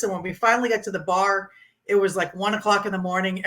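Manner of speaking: ordinary delivery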